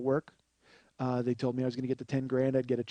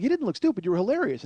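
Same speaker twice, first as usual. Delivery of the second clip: high, louder protest; mock emphatic reassurance; 7 semitones higher, 5 dB louder, faster than usual. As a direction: insist he was great. Family protests.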